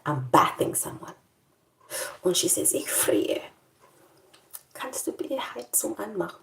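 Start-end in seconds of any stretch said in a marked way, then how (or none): 2.7-6.4 put-on soft whispered old lady; old patient whispering she is cold; frail whisper.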